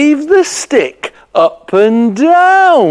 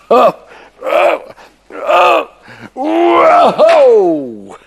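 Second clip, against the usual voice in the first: rising amazement; 8 semitones higher, slower than usual. Acted. Simon feels the magic begin, rising whoa.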